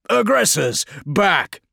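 high, loud battlefield command; sharp commander's shout; ordering. Acted. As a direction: shout the squad back. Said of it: "Felix ordered".

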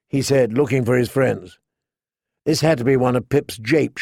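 ordinary delivery, nothing marked

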